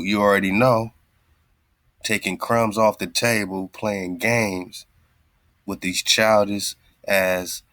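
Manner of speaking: usual speaking voice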